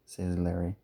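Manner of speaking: plain reading voice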